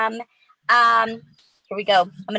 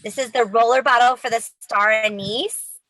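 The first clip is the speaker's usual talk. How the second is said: ordinary talk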